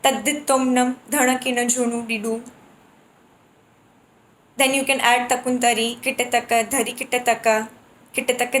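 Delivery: rhythmic bol recitation; building a jati. Shown as a demonstration. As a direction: chant the groups in time. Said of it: "You have to select one group".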